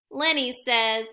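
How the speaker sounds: ordinary delivery, nothing marked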